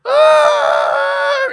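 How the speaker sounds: high sudden scream of panic; startled scream. Retold or imitated. Retelling how his wife panicked at the wheel, a sudden high scream.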